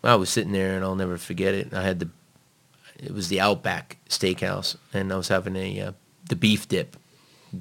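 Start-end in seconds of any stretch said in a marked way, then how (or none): none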